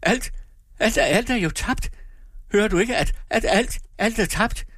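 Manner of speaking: very high, desperate wail; despairing cry. A narrator's reading. Husband telling Lina all is lost, despairing. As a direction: break down in halting repeats. Continues his shouted despair.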